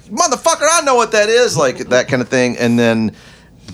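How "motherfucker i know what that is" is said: mock exasperated shout.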